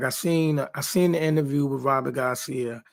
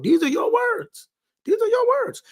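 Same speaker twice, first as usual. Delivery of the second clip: much higher, disbelieving squeal of these are your words; incredulous squeal; 4 dB louder than usual. Acted. Pundit to trainer Robert, mocking his own words in a high squeal.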